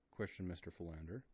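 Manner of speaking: ordinary delivery, nothing marked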